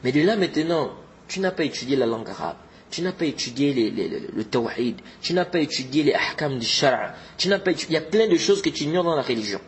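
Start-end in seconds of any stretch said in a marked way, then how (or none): none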